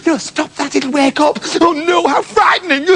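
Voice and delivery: high worried mother pleading; mock fright. Mother afraid wolf wakes.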